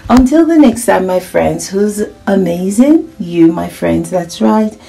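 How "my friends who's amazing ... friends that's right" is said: usual speaking voice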